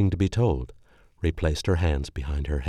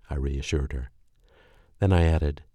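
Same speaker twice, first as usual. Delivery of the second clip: ordinary delivery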